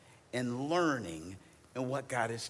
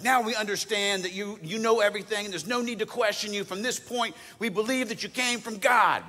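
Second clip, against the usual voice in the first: disciples' voice; 8 semitones higher, 6 dB louder than usual. Retold or imitated. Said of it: "the disciples are like".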